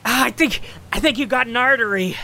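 high-pitched pained voice; acted hurt man's anxious whine; wounded captive. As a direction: say it high, as if in pain. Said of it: "He's like".

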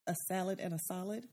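SALAD with short A, SOLID with open AH; contrasted pronunciation. Shown as a demonstration. Co-host testing SALAD against SOLID, vowels kept distinct. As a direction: quietly separate "SAL-id" from "SAH-lid". Contrasting the two disputed words.

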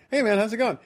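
retold easy hello, pitched well up; casual greeting. Walking up to someone at a party, breezy high hello. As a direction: breeze up to a friend with it.

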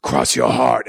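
low, ominous monster voice; gargoyle voice. Acted. Gargoyle sealing the promise with the man, low menacing voice. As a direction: drop deep like a menacing creature. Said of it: "then the thing goes".

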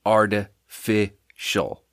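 "artificial" broken into slow, separate chunks; syllable by syllable. Shown as a demonstration. Host judging the drink's taste, "artificial" slowly broken up. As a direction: pause between each part of "artificial". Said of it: "Three syllables".

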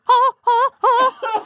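high, loud mock wails; face-melting cries. People melting as the Sphinx opens, high mock wails. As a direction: cry out as if your face melts. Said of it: "people's faces start melting, like".

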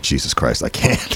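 soft, low, unvoiced groan of despair; Robert Townsend. Robert Townsend despairing at the Eddie Murphy auditions, soft and low. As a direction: mutter it under your breath, defeated. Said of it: "Robert Townsend's like".

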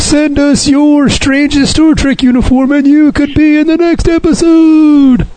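high, slow cheesy announcer. Pretend. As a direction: read it like a goofy casting call. Mock fan-mail ad call-out.

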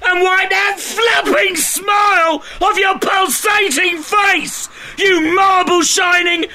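bank robber. Acted. Robber yelling at the clerk.

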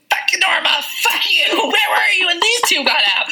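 hammed-up rage at a colleague; mock furious yell. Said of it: "she was like".

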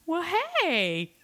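high, drawn-out hello; delighted retold greeting. Woman spotting a past fling at a gig, delighted greeting.

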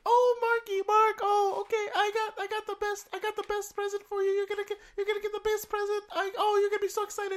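very high, excited mom voice; man doing a woman's voice; impression of his mom. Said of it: "Do an impression of my mom".

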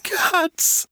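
put-on very high, sobbing child in pain; hurt boy crying, high-pitched. A narrator's reading. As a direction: gasp out single words through tears. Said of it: "the boy sobbed".

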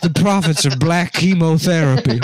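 Southern dandy fighting back laughter; hammed-up Southern dandy voice. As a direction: proclaim it like a Southern gentleman. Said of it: "My cool Southern dandy voice".

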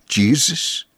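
understated incredulous question. A narrator's reading. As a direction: ask it as if hardly believing. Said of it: "he asked incredulously".

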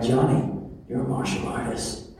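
low, hushed, steady self-coaching; inner calm self-talk. Retold or imitated. Comedian quoting his calm inner voice, low and hushed. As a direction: talk yourself down like a focused martial artist. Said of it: "I'm like".

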